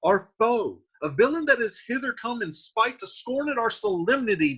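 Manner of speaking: high, pressing accusation; warning his uncle of an enemy; urgent Tybalt. Acted. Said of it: "Tybalt says".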